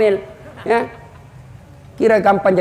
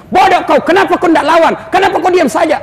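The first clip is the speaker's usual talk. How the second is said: wife scolding; 7 semitones higher than usual. Retold or imitated.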